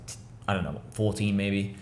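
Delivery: ordinary delivery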